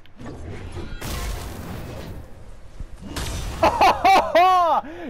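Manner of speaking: long high laugh bursting out; delighted laughter. Real, not acted. Gamer thrilled at the hammer, delighted laughter.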